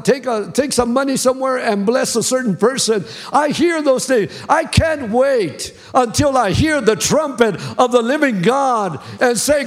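fervent preacher lifting the crowd; preaching. Acted. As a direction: preach it out with full fire. Preaching at full force.

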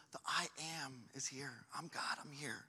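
very soft, low, near-whispered proclamation; bold, hushed declaration. Acted. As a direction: declare it solemnly under your breath. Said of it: "in a bold moment here, he goes".

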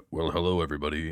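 low, put-on host greeting; podcast voice. Host recalling his first episode greeting, low podcast voice. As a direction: drop into a deep show-opener voice. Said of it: "it's a podcast voice".

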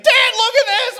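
very high, loud yell to dad; squealed, showing-off shout; gleeful kid. Retold or imitated. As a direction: imitate a boy shrieking with delight. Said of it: "Oh, and he's laughing".

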